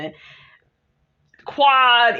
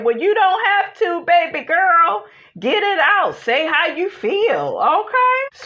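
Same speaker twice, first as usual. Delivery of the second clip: high, sugary mock-patient voice; imitating Jackie; 8 semitones higher than usual. Imitating Jackie soothing Sweet Tea, high and sugary. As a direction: play Jackie talking down sweetly. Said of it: "Jackie said".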